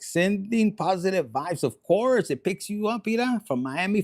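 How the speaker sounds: usual speaking voice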